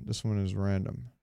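ordinary talk

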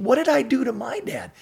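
put-on indignant teen disbelief. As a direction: protest as a wounded eighteen-year-old.

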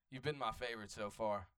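ordinary talk, nothing marked

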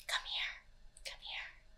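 whispering come here softly; low secret whisper; whispered lure. Retold or imitated. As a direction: coax a toddler in a hushed whisper. Voicing kidnapper luring toddler.

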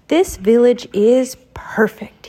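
slow breathy whispered sigh. A narrator's reading. Mickey admiring the village, slow whispered sigh. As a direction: breathe it out slowly on a contented sigh. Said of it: "he said with a sigh".

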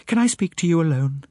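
high, breathless lady; somewhat breathless. A narrator's reading. Lady arriving to see the detective, somewhat breathless. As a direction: stumble a little, short of breath. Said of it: "she said somewhat breathlessly".

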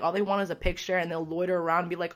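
ordinary talk, nothing marked

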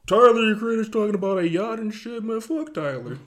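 high, loud mocking fan rant; annoyed fan voice. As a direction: mimic a bitter fan complaining. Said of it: "And people's like".

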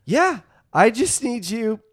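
a principal smugly calling the bluff; smug mock principal. Principal mocks caller, retold.

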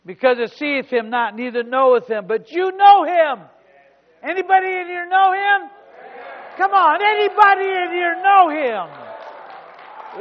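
loud, high preacher's cry; shouted pulpit preaching, building; full-force preaching. Acted. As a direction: preach it out to the back row.

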